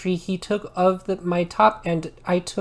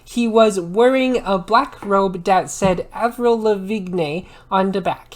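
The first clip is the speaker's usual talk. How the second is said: ordinary delivery, nothing marked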